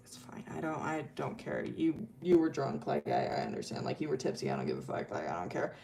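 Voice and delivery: very soft, low brush-off; dismissive reassurance. Retold or imitated. Arden brushes off Milo's tipsy apology, quietly dismissive. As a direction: wave the apology away under your breath.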